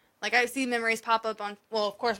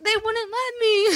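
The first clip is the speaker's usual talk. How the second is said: laughing excuse; 11 semitones higher, 5 dB louder, slower than usual. Real, not acted. Laughing retelling her excuse.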